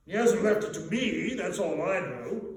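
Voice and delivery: loud, high blustering merchant; great fat man. A narrator's reading. Fat businessman dismissing the talk, loud blustering voice. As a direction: bark it out like a stout businessman. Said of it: "said the man with the chin".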